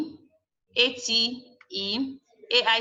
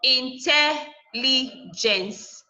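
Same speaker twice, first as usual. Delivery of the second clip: "Intelligence" very slowly, in separate pieces in, tel, li, gence; syllable by syllable; 2 semitones higher, much slower than usual. Shown as a demonstration. Teacher splitting a word for students, syllable by syllable. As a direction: sound out "Intelligence" with gaps between syllables. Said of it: "third to the last syllable".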